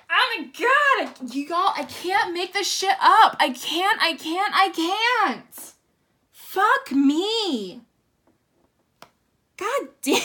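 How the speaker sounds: loud, high, disbelieving exclaims; can't believe what she's seeing; real excited disbelief; stunned disbelief. Real, not acted. Stunned by card reveal.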